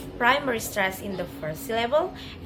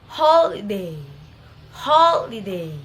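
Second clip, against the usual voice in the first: "holiday" with HOL loud and long; stress on first syllable; 8 dB louder than usual. Shown as a demonstration. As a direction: hit HOL in "holiday", a lighter stress on DAY. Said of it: "primary stress in the first syllable".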